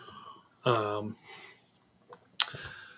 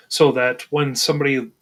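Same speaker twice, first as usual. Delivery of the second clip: usual speaking voice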